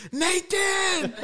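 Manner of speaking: wife shouting for her son, mock yell; calling out. High mock shout of the name.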